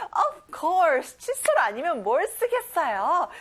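ordinary talk, nothing marked

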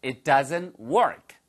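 very slow, careful teacher model; drawn out for learners to repeat; slow, deliberate teacher demo; slow model reading. Shown as a demonstration. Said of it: "네".

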